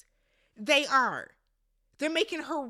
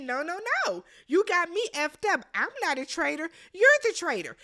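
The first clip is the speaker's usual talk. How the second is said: higher-pitched retold protest; mock indignant denial; 5 semitones higher, 4 dB louder than usual. Wes turning the accusation on Boston Rob, mock indignant denial. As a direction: imitate an accused man firing the charge back.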